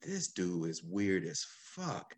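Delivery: low, slow, under the breath; slow muttered scoff; dismissive mutter. Retold or imitated. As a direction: mutter it to yourself, unimpressed. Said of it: "I was like".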